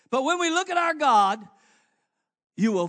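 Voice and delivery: high, loud preaching. Acted. Preacher lifting the church toward God. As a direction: build it up.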